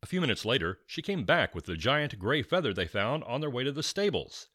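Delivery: ordinary delivery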